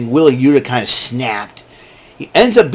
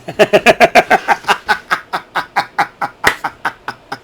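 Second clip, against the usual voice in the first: long high giggling fit; long laugh; 6 semitones higher than usual. Real, not acted. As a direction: crack up at your own joke. Laughing at own bit.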